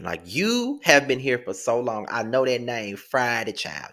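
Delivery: normal talking voice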